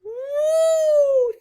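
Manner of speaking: high, loud cheer of joy; joyful whoop. Acted. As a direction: whoop it out with delight. Wolf rejoining pack cheering.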